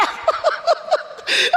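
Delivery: a high, breathy burst of laughter; overjoyed laugh. Real, not acted. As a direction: laugh with tearful joy. Opening the envelope of money.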